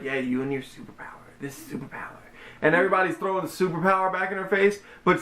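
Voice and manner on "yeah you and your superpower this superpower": low, soft, fast sneering jab; sassy mock Regina. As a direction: mutter it dismissively. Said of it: "Regina's all like".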